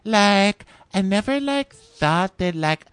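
mock her in high-pitched upspeak. Mocking the first one to talk, high upspeak. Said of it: "No, everything's in upspeak".

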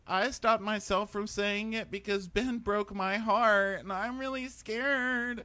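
put-on very high, slow whiny girlfriend; mock insecure. She doubts the guy's love, mock insecure. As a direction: mimic a needy woman, high and dragged out. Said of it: "she gets insecure again".

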